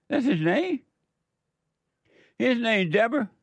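high, incredulous rise on his name; can't believe that's a man's name; amazed disbelief. Real, not acted. Radio host amazed at a man named Deborah, high disbelief.

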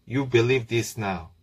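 usual speaking voice

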